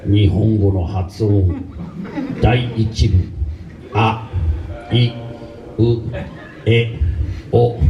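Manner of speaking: slow mock recording voice; language tape voice. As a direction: read it slowly like an instructional tape. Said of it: "it would go".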